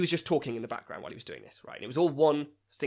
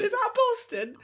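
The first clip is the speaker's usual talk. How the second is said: much higher mock dim tourist; silly high voice; 5 dB louder, slower than usual. Podcaster echoes the joke in a high silly voice. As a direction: wonder aloud like a dopey tourist.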